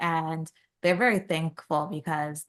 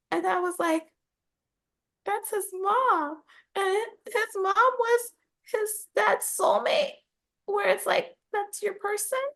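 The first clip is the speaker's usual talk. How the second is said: very high, choked-up reacting voice; teary, moved; 13 semitones higher than usual. Retelling the dad's lost soulmate to co-host, teary and high. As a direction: squeak it out as if close to tears. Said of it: "a little bit watery at that point".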